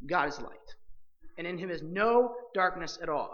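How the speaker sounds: ordinary delivery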